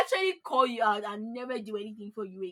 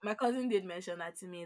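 ordinary talk, nothing marked

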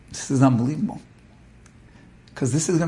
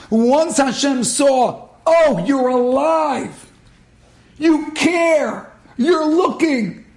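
preacher voicing God, raised and pleading; God calling out; 10 semitones higher, 3 dB louder than usual. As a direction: call out to a lost son with urgent love.

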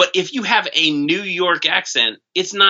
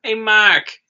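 high, mocking Boston accent; 6 semitones higher, 4 dB louder, much slower than usual. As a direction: put on a hammed-up Boston yell.

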